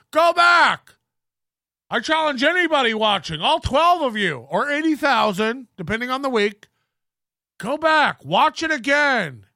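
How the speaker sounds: high, hammed-up outraged yelling; mock shouted challenge. Imitating Corey challenging his few viewers, mock outrage.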